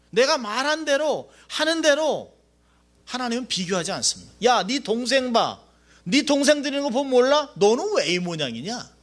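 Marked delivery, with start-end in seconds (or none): 4.4-8.9 nagging parent. Retold or imitated. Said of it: "부모들 같이".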